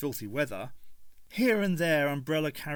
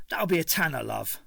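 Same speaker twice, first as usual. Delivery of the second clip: Cockney stallholder; 3 semitones higher, 3 dB louder than usual. A narrator's reading. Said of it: "His Cockney accent".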